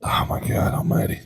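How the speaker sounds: hushed whisper of mock awe. Real, not acted. Whispering in mock awe at the compliment. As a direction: breathe it out in mock wonder.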